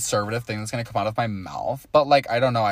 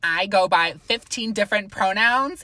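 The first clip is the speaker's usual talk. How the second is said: much higher bubbly influencer voice; Trisha Paytas; 9 semitones higher, 5 dB louder than usual. Retold or imitated. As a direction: gush like a YouTube star. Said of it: "big Trisha Paytas vibes".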